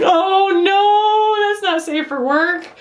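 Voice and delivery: high, sung-out "oh no"; high-pitched scandalized exclamation; playful dismay. Real, not acted. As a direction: wail it in amused mock alarm. Mock alarm at suggestive mishap.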